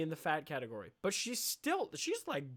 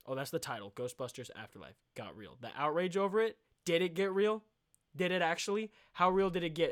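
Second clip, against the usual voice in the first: ordinary talk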